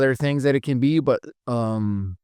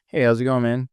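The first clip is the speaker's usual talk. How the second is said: normal talking voice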